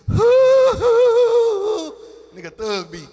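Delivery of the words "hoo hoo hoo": very high, loud falsetto hoo hoo. Retold or imitated. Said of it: "Prince said".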